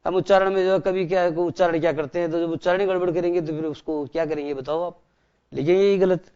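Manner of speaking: ordinary talk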